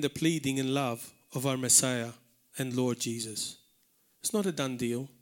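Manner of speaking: usual speaking voice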